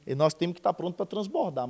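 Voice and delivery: normal talking voice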